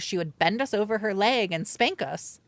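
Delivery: normal talking voice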